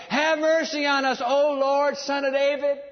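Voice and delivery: high, loud pleading call; crying out. Acted. Two blind men calling to passing Jesus, crying out. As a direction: call out for mercy across a crowd. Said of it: "cried out saying".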